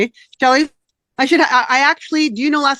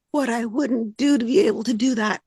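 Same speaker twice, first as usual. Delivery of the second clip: holding back real tears; choked up; 5 dB quieter than usual. Real, not acted. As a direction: voice breaking into tears, softer. Choked up over missing teaching.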